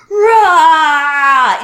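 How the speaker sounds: imitated monster yell; beast roar. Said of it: "The beast awakens".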